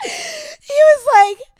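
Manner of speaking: words squeezed out high and slow between laughs; can barely get the words out for laughing; high laughing drawl; laughing through words. Real, not acted. Trying to talk through laughter.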